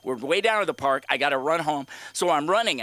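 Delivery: ordinary talk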